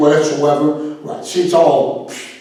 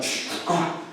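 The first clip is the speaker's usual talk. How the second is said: scared hush gasp; 13 dB quieter than usual. Pretend. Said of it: "I'm like".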